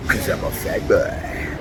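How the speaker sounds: hammed-up self-mocking tag line; mock fat boy voice. Same catchphrase repeated.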